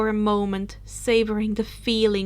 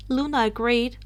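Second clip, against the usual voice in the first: plain reading voice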